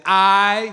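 "I" held long and loud; emphatic. Shown as a demonstration. Preacher insisting it be said properly, emphatic "I". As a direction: lean hard on a drawn-out "I". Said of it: "say it".

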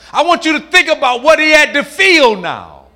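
normal talking voice

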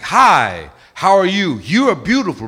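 loud, swooping creature come-on; imitating strong men. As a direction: call out like creatures posing as men. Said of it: "their voice sounded like grown, strong men".

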